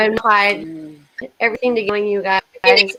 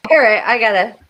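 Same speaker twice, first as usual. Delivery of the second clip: usual speaking voice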